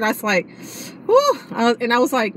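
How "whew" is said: relieved whew. Retold or imitated. Relief exclamation in story.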